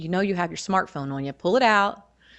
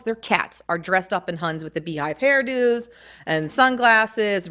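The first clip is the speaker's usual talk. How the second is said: normal talking voice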